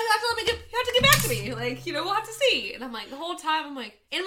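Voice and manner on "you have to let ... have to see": higher, playing it dead serious while teasing; deadpan in character. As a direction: keep a straight face, fake bureaucrat. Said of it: "[name] never dropped character".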